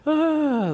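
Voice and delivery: sharp gasp into a high 'ah'; sucked-in breath and high sigh, acted; gasp of rush. Recalling the drop from the dive, acted gasp. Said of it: "I can still feel that rush".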